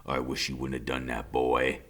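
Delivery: quiet menacing father. A narrator's reading. Father answers low.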